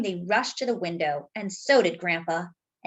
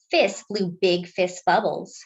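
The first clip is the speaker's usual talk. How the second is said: plain reading voice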